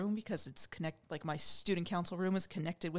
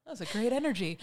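giggly, slightly louder praise; laughing mock gush; 3 semitones higher, 4 dB louder than usual. Co-host giggling while praising the calm energy. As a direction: let the laugh spill into the words. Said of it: "I was like".

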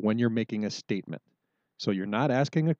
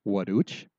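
"Waduch?" with a rising tune; teacher acting the question; asking a question; 4 dB louder than usual. Shown as a demonstration. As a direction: ask "Waduch?" in the quick dialogue. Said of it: "run through that again in ... quick conversation".

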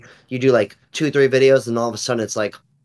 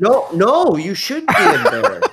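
high put-on voice, pretending to soothe; hammed-up comfort for an embarrassed friend; mock reassurance; 8 semitones higher, 4 dB louder than usual.

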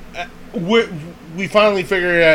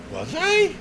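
surprised. Retold or imitated. Surprised at friends' accusation.

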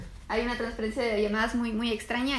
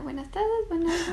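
Truman impression; 5 semitones higher than usual. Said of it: "Cuando decía".

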